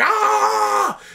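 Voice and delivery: high loud hammed-up scream; mock scream. Retelling Bruce Willis opening the orb, mock scream. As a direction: shriek it like a movie hero in agony. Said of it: "And he was like".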